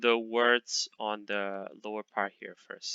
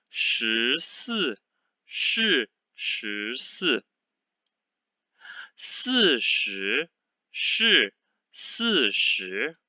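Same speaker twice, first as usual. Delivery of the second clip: a measured read-along with less drawn-out gaps; somewhat faster reading; much slower than usual. Shown as a demonstration. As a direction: still slow, syllables more connected. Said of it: "this time I will be faster in my speed".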